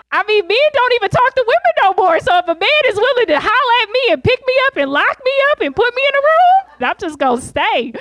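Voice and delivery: ramp the rant up and up; a giddy escalating rant about men; excited rant. Real, not acted. Comic rant about men, rising.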